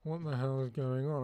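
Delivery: ordinary delivery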